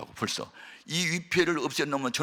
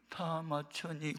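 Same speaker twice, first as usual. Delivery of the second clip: much softer, very slow question; weak waking voice; 13 dB quieter, much slower than usual. Retold or imitated. Dazed father waking, asking if it's over, faint. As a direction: ask faintly, just coming to.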